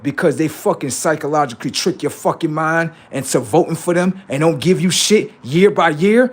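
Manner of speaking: ordinary delivery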